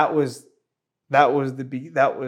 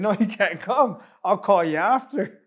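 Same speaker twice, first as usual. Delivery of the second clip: laughing refusal; 4 semitones higher than usual. Retold or imitated. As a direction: laugh through a light brush-off. Brushing off friend, laughing.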